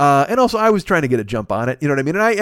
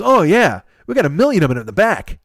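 high, loud clerk's boast; retold emphatic confirmation; 5 semitones higher, 5 dB louder than usual. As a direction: imitate an oblivious clerk happily confirming. Retold clerk cheerfully confirming stock.

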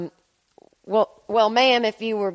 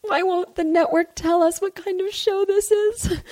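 high fretful complaint; 6 semitones higher than usual. Acted. Character breaks frame, genre complaint.

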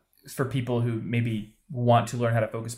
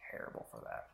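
breathy whispered joke; whispered aside; 22 dB quieter, faster than usual. Acted.

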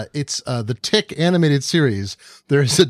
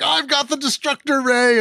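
high-pitched, hammed-up villain rant; mock angry villain; 11 semitones higher than usual. Cohost imitating an angry cartoon villain with a ludicrous plot, mock angry. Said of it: "so angry that he's like".

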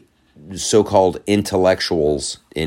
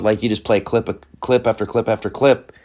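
normal talking voice

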